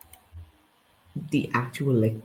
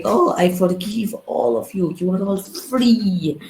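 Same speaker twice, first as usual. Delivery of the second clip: higher, slow and lofty, mocking the queen; lofty mock royal forgiveness, drawn out; mock gracious queen; 7 semitones higher, 3 dB louder, slower than usual. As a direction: play the queen as sweetly magnanimous, higher and slower.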